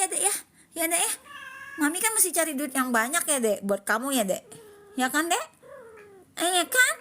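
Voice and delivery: doting high sing-song; pet talk. Real, not acted. Cooing to her cat.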